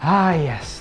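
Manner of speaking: normal talking voice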